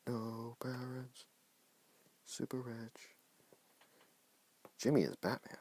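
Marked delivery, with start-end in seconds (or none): 0.1-3.1 mock brooding hush. Dramatic reading of story.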